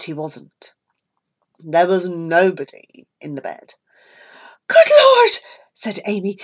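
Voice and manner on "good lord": high, loud startled cry; alarmed cry. Acted. Amy finding the boy's bed empty, crying out in alarm. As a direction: gasp it out in shock.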